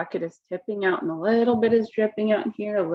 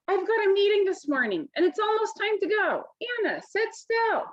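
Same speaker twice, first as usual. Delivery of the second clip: rushed mom; 10 semitones higher than usual. Acted. Hurried mom before work.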